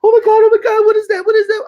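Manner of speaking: high, loud panicked crying; retold girlfriend crying at the window in fear; terrified crying. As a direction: imitate her sobbing in terror. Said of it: "And she's like".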